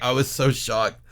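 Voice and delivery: ordinary talk, nothing marked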